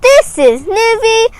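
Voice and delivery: high, loud, upbeat outro; cheery sign-off. Real, not acted. Storyteller asks viewers to subscribe, loud and excited. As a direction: project brightly to the viewers, stretching the final words.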